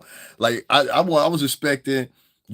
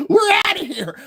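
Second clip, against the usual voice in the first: much higher shout; the wig yelling as it flees; high cartoon yell; 4 dB louder than usual. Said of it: "That motherfucker's like".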